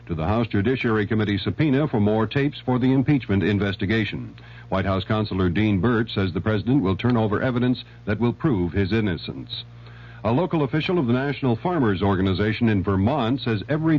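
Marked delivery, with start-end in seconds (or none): none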